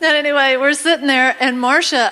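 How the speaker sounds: ordinary talk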